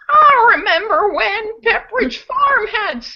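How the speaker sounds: put-on high, quavering old man voice. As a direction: warble it like a weepy grandpa. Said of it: "you did that a little too well".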